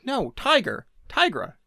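high exasperated "No, Tiger, Tigra, Tiger"; exasperated correction. Retold or imitated. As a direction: correct them in a high, fed-up voice. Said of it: "and it's like".

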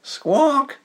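very high squawking cry; shrill bird squawk. A narrator's reading. Tug sprouting tentacles, squawking. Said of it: "said Tug".

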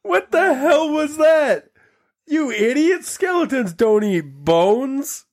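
very high, slow, drawn-out disbelief; incredulous outburst. Real, not acted. Host berates caller's silly joke, high-pitched incredulity. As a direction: squeal each word slowly in helpless disbelief.

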